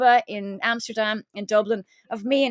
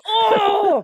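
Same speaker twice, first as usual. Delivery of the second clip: high, loud laughing oh; mock audience gasp; 10 semitones higher, 7 dB louder than usual. Retelling a crowd's reaction at the stage show, mock gasp. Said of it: "And everyone's going".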